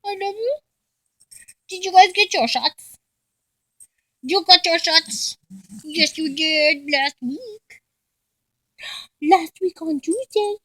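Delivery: high, cooing pet voice; high-pitched talk to a beloved pet. Real, not acted. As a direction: coo sweetly at the dogs. Baby talk to her dogs.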